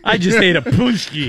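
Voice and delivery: gushy mock echo of her line; imitating gushing. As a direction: parrot her breathless delight. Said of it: "the urge to say it like that".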